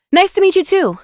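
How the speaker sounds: very high, loud blurt; high-pitched, forced cheerful outburst; panicked interruption. A narrator's reading. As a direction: cut them off with a frantic, too-loud greeting. Amani cuts Xavier off in panic.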